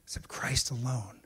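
hushed whisper on Christ alone. Real, not acted. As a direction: drop to a breath on the truth. Hushed emphasis on the key point.

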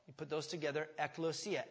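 ordinary delivery

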